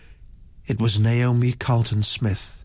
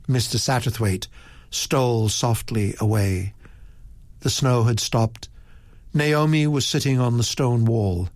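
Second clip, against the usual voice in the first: usual speaking voice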